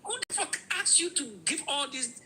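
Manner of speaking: high, fed-up outburst; exasperated rant. Real, not acted. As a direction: boil over at the pointless details. Boiling over at details.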